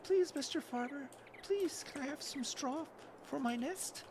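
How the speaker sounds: high pleading little bird voice; begging bird voice. Acted. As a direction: beg like a tiny bird. Voicing begging bird, named line 28.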